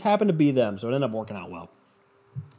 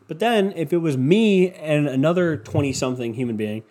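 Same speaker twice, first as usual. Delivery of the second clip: ordinary talk, nothing marked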